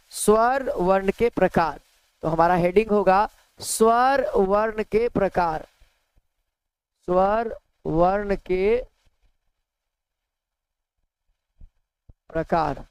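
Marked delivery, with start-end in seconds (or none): 3.6-12.8 slow dictation. Shown as a demonstration. Teacher dictating a heading to students, slow and spaced. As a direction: dictate the heading slowly, word by word. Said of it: "teacher dictating a heading".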